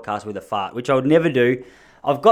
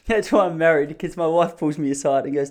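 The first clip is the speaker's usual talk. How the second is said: usual speaking voice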